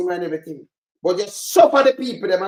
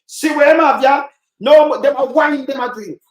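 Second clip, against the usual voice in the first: indignant shout; 6 semitones higher, 8 dB louder than usual. Real, not acted. Bystander protesting to the crowd, loud indignant call. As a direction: call it out in disgust. Bystander complaining about the rich.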